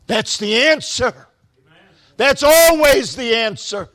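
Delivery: loud, high, impassioned exhortation; full-force preaching. Real, not acted. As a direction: preach it hard, stretching the key words out.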